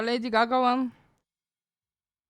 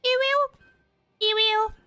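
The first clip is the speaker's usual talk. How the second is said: put-on squeaky high girly ew; very high e-girl squeak; man doing a woman's voice; 11 semitones higher, slower than usual. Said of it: "Where is my fucking girly pop voice?".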